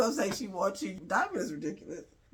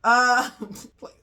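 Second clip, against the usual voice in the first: laughing scoff; 5 semitones higher, 11 dB louder than usual. Real, not acted. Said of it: "speaker scoffs at Fantana".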